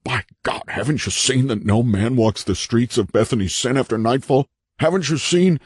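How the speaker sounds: high, strained, incredulous plea; haunted man, frantic. A narrator's reading. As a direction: press the question with rising desperation.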